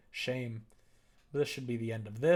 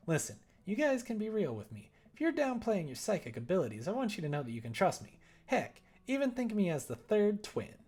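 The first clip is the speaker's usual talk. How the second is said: put-on higher teen girl voice; bubbly teen girl; 8 semitones higher than usual. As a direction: play an eager teen daughter. Voices teen daughter Amanda.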